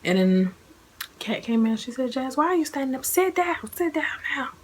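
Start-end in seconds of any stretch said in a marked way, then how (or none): 2.1-4.5 higher, urgent, whispered sit down; a friend urgently telling the new mother to sit down; alarmed scolding. Retold or imitated. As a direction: hurry her back to the bed. Friend alarmed she stands up.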